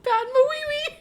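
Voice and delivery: very high, loud, laughing the name out; real giggle fit on the silly name; squealing laughter. Real, not acted. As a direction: crack up all over again.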